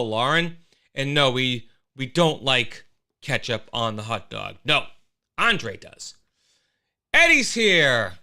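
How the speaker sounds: usual speaking voice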